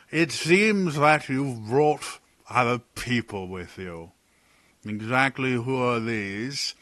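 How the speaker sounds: slow, clipped-back scolding from afar; lightly voiced annoyed official; irritated guard. Guard scolds Talon for skipping protocol, irritated. Guard asks about strangers.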